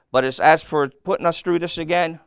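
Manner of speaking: ordinary delivery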